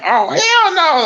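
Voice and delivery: high, drawn-out mock outrage; comic high-pitched yell of refusal; loud indignant outburst. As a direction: stretch it out high and loud, appalled. God reacting in acted bit.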